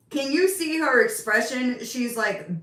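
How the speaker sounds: ordinary talk, nothing marked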